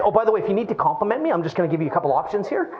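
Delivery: fast mock pitch. Mocking pushy agent.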